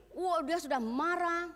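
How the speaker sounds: ordinary talk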